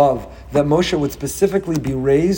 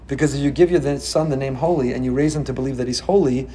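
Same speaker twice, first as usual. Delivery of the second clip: usual speaking voice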